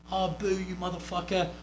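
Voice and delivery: imitated jeer from the stands; mock crowd heckling. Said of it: "people will start yelling at you".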